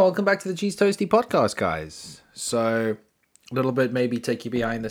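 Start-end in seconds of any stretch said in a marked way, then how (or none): 0.0-1.6 higher, upbeat host announcement; bright host intro. Acted. Host welcoming listeners to the Cheese Toasty podcast, bright announcer read. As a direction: open the podcast like a radio host.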